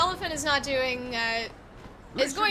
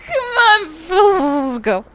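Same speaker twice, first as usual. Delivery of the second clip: shouted, higher, mocking; loud mock repeat; 5 semitones higher, 14 dB louder, slower than usual. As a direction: bellow it back mockingly. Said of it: "repeats thug line".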